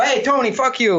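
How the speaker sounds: high, breezy retold greeting; jokey high-pitched hello; mock cheery insult. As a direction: toss a playful insult at a fan. Acted jokey greeting at book signing.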